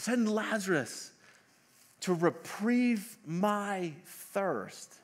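high, very slow, drawn-out demand; pleading rich man. Retold or imitated. Rich man in hell asking relief, slow mock plea. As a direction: stretch each word in a grand mock plea.

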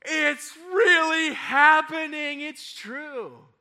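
put-on gasp, then high, slow, loud child cheer; imitated breathless childlike joy; thrilled amazement. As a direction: cry it out like an amazed kid. Kids gasp, convinced it is true.

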